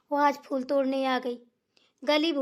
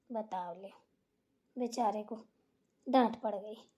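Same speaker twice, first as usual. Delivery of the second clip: much softer, lower regretful aside; muttering to herself; 6 semitones lower, 7 dB quieter than usual. Acted. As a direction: mumble guiltily to yourself. (पछतावे में धीरे से बुदबुदाना)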